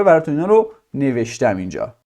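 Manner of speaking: ordinary talk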